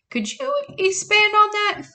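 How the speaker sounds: put-on high, clipped girl's question. A narrator's reading. Sadie pressing Amos for answers, high-voiced. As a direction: voice Sadie a pitch up.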